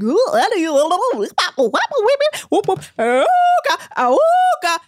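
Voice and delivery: loud, high cartoon whoops and honks; mock his goofy impressions with rising ah-ooga noises; zany impression noises. Host mocking Eddie's classic impressions, zany high whooping. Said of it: "one of his classic ... impressions".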